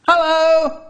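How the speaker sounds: high, loud call through a door. Acted. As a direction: call out cheerfully to someone inside. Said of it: "My dad calls out".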